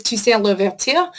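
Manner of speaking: Toussaint Louverture in a French accent, tusan luvertur. Shown as a demonstration. Teacher shows the class the name, in a French accent. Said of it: "my French accent game is on point".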